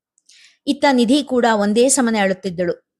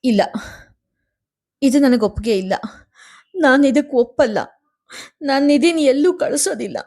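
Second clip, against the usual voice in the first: wailing refusal; 5 semitones higher than usual. A narrator's reading. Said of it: "ಕಲ್ಲು ಕರಗುವಂತೆ ರೋಧಿಸಿದಳು".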